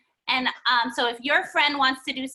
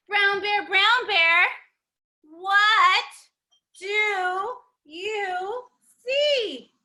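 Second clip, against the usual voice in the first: put-on reading to children; 3 semitones higher, much slower than usual. Reads the picture book to kids.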